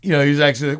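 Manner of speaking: ordinary delivery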